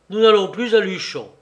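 éclat plus fort et plus aigu; lâcher la nouvelle avec colère; annonce fâchée. Acted. (Lionel annonce à Henry, fâché et fort)

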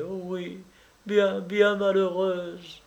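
high and slow, near tears; tearful. A narrator's reading. Mistress curling up against her lover, tearful. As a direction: plead through tears. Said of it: "les yeux pleins de larmes".

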